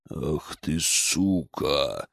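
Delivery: low, hushed, menacing growl; vicious growl. A narrator's reading. Медведь recognises his enemy, growling viciously. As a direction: growl it low and slow with venom. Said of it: "Злобно прорычал медведь".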